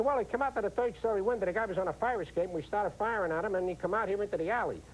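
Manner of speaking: high-pitched New York accent impression. Comedian mimicking locals on New York newscasts, in a high New York accent. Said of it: "just to hear the accents".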